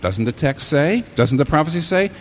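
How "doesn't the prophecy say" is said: ordinary delivery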